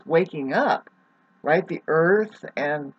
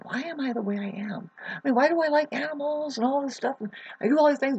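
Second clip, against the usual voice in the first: higher-pitched voice of someone puzzling over themselves; wondering aloud; 9 semitones higher, 3 dB quieter, faster than usual. Acted. Astrologer voices a curious person questioning their own nature, wondering aloud. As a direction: lift it high, questioning yourself. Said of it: "like you were wondering".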